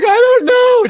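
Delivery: high-pitched, breaking into laughter; crying with laughter; laughing. Retold or imitated. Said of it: "just crying, laughing".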